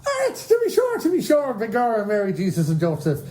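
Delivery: over-the-top stage Irish brogue; mock Irish accent. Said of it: "Donald Sutherland's accent does need to be heard".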